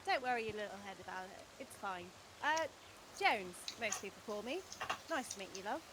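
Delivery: cosy copper. Acted. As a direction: soothe her gently like a friendly bobby. Player voices a copper, soft reassuring.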